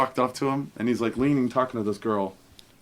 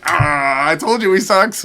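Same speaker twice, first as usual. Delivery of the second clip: mocking teammates; 6 semitones higher, 10 dB louder, slower than usual. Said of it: "all those Bucks guys are like".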